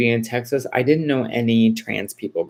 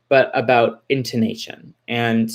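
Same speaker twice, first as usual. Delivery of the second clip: ordinary talk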